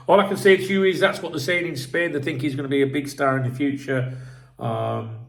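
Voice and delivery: normal talking voice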